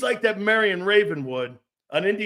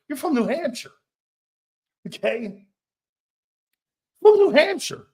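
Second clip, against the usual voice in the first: high-pitched disbelief, louder on the repeat; mock incredulous; 8 semitones higher, 4 dB louder than usual. Host confronting a fake Cajun from New Hampshire, incredulous. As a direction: call out a faker, pitch jumping up.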